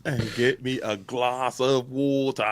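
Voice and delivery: slow mock American; lightly mock American; American accent. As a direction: drawl out the water order. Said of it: "doing American".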